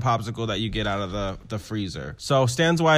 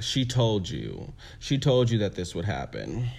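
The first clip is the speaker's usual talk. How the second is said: low, softer I-told-you-so; imitating scolding mother; 5 semitones lower, 7 dB quieter than usual. Electra telling Candy off after the loss, low and measured. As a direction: quote her in a lowered voice. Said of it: "Electra basically says, like".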